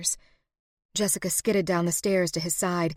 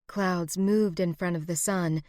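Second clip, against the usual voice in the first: plain reading voice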